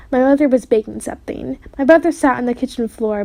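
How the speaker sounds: plain reading voice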